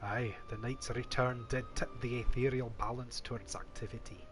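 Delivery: voiced in a put-on Scottish accent. Player voicing the scholar in a Scottish accent. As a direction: roll it out like a Scottish elder. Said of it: "I gave him a Scottish accent".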